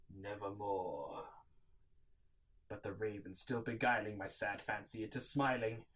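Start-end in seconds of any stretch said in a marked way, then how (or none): 0.1-1.3 low, slow, eerie; raven's refrain. Acted. Narrator echoes the raven's word, low and slow. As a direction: toll it like the raven.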